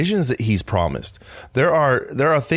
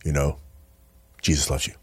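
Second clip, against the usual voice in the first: low, quiet, lifeless mock preaching; mock dull evangelist; 8 semitones lower, 5 dB quieter than usual. Mocking the worst evangelist, low quiet and joyless. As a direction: mumble it like the most bored evangelist alive. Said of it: "the worst evangelist".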